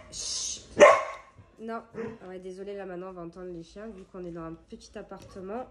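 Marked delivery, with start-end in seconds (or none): 0.1-0.6 unvoiced shush to quiet the dog; a quick hiss of chut to settle the dog; soft breathy shush; hushing shush. Real, not acted.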